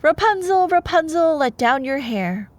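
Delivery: high loud call to the tower; witch calling up. Acted. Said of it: "she says".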